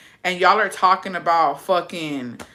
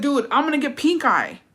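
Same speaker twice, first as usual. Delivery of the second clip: higher, freaked-out voice; mock panicked whine; 8 semitones higher than usual. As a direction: panic about pink eye. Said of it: "'Cause I was like".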